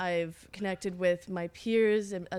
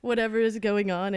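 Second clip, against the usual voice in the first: ordinary talk, nothing marked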